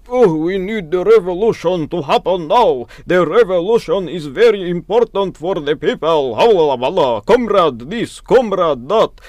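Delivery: rally the comrades in a hammed-up Russian accent; over-the-top Russian accent of a fiery communist. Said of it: "I use the Russian accent".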